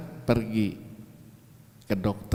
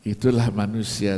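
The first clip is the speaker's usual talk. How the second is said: usual speaking voice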